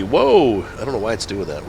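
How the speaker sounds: ordinary talk, nothing marked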